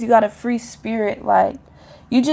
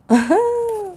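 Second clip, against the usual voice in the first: high laugh; 10 semitones higher than usual. Real, not acted. Laughs at her own quote.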